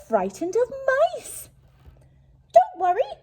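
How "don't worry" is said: high, loud reassuring squeak; mouse peeping. Acted. Mouse reassuring the frightened lion, high squeak. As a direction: comfort the scared lion brightly. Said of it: "Mouse peeped".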